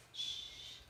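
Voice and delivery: a soft hushing shh; shushing. Shown as a demonstration. Teacher showing kids how whispering sounds. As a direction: hush them with a breathy shh.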